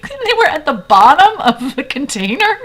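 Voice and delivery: high, loud incredulous question; imitated horror. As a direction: play a friend appalled and demanding confirmation. Friend horrified by fries from container.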